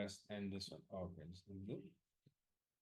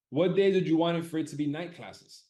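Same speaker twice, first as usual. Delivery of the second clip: high, loud announcement of the question; loud poll announcement; announcing the poll question; 7 semitones higher, 12 dB louder than usual. Real, not acted. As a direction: call it out to the whole room.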